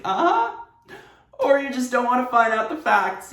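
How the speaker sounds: sweet fake uh-huh sliding into a pointed jab; polite smile hiding the sting; passive-aggressive. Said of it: "I was so passive-aggressive".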